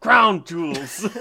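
loud, high, slow British put-on; hammed-up broken-English British; broken-English British accent. Shown as a demonstration. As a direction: boom it out in mock British, half laughing. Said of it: "do some broken English in a British accent".

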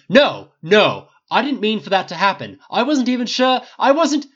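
desperate denial, cut off at the peak; panicked protest. A narrator's reading. Harry denying he meant harm, frantic.

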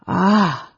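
loud, high gasp of awe; awed gasp. A narrator's reading. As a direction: gasp aloud in wonder. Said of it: "the stranger gasped in awe".

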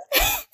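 quick high-pitched giggle; a bright little burst of laughter; embarrassed giggle at herself. Real, not acted. Laughing about crying at falls.

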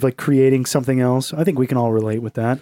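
ordinary talk, nothing marked

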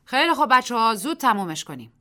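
high, loud push to get it done; loud urging. Acted. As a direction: hurry the crowd along. Mr Summers urges the crowd on.

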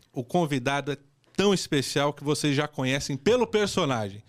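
ordinary talk

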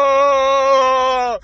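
loud, drawn-out cry of frustration; frustrated scream. Real, not acted. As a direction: let the oh burst out in real exasperation. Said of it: "that's my scream of, like, frustration".

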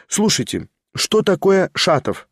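lightly voiced young woman, wistful then eager; a hint of a lively young lady, lifted a little; young lady Liza. A narrator's reading. Liza asks.

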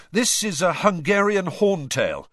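put-on young dragon keeper, a bit out of breath; slightly breathless Charlie briefing Hagrid; Charlie Weasley.

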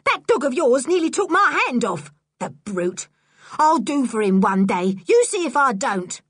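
high, indignant wail; howling outrage. A narrator's reading. Woman nearly bitten by boy's dog, outraged. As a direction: complain loudly about the attack, threatening. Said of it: "howled Missus Stick".

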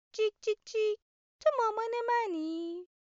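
high, loud chirpy chick voice cheeping its question; little lost chick. Acted. Lost chick asks the cow for its mum, high chirpy cheep. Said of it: "جوجه بهش گفت".

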